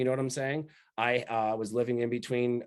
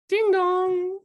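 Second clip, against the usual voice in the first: high, loud sung doorbell chime; mimicking a doorbell, pitched way up; doorbell ding dong; 7 dB louder than usual. Imitating the doorbell at night.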